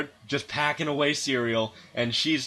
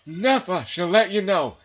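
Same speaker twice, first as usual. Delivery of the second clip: put-on higher, louder, put-upon old man with a laugh; weary husband's inner voice; 7 semitones higher, 6 dB louder than usual. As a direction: voice the husband's silent despair, hammed up. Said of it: "the face of a man that says".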